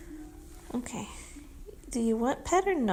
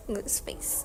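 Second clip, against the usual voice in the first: whispering; 6 semitones lower, 7 dB quieter than usual. Real, not acted. Whispering about cat.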